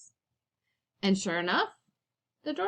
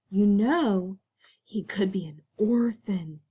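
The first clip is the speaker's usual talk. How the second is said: hissed. A narrator's reading. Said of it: "hissed Aunt Figgy".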